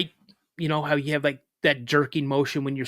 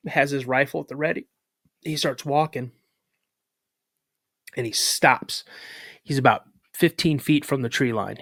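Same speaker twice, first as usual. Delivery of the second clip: ordinary talk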